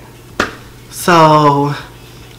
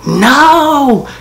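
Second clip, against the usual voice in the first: high frantic cries of no; dad wailing no in high panic; dad panicking; 11 semitones higher, much slower than usual. Retold or imitated. Dad outside, finding the phone, high panicked wailing. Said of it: "I heard my dad, like, outside".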